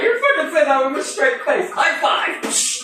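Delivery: loyalists cheering high and giddy; excited high praise. Retold or imitated. His loyalists cheer him for keeping a straight face, excited. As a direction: pitch it up like a thrilled sidekick. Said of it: "they're like".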